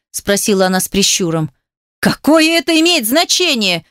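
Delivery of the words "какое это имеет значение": high-pitched exasperated demand; fed-up, pitched-up retort; losing his temper. A narrator's reading. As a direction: burst out in anger. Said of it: "вконец разозлился он".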